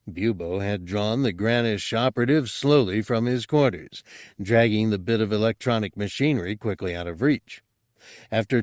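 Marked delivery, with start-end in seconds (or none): none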